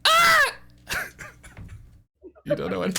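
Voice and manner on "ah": very high, loud falsetto shriek; sudden piercing mock scream; acted scream. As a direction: shriek out in comic pain. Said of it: "Moo goes".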